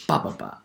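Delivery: "ba, ba, ba" as a low rhythmic beat; stress pattern demo. Shown as a demonstration. Lecturer beating out the stress pattern, "ba, ba, ba" in rhythm. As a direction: tap out "ba, ba, ba" softly in syllables. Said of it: "the one O O stress pattern".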